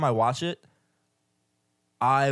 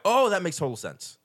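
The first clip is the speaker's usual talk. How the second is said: high-pitched dawning realisation; imitated aha moment, pitched up then falling; acted realisation; 6 semitones higher than usual. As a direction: act out it suddenly clicking. Acts out viewer finally getting it.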